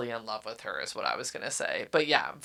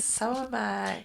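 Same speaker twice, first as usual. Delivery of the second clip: sultry flirt; 6 semitones higher, 5 dB quieter than usual. Retold or imitated. Heroine's flirty comeback voiced.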